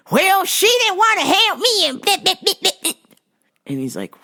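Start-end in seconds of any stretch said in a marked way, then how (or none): 0.1-2.9 high, loud mock complaint from an offended lady; hammed-up high whiny lady voice; whiny customer. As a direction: whine it like a wronged customer.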